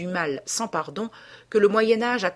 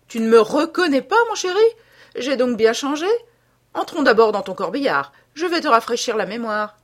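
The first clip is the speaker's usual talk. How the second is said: louder, higher, mock-affectionate taunt; taunting familiarity; 4 semitones higher, 6 dB louder than usual. A narrator's reading. The cadaver accosts the lawyer, loud taunting familiarity. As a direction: needle an old acquaintance with loud false tenderness. Blackmailer taunts his old lover.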